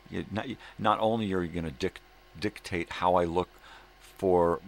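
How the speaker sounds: loud, heated tirade, rising to shouting; angry rant. Real, not acted. Ranting at employers about their rules, furious and loud. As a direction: let the anger boil over at the bosses. Ranting at bosses who dictate his life.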